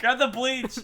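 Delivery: high-pitched comic order; hammed-up high cry to the crew; mock urgency. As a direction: call it out in pretend panic. Joking cleanup order, pretend panic.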